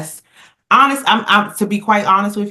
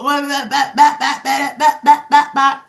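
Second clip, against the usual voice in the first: high mocking blah-blah of someone talking over a gunman; mocking chatter; 9 semitones higher, 5 dB louder than usual. Mocking the woman who kept talking at gunpoint, high blah-blah. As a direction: parrot her nonstop talking, mockingly. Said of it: "she still go".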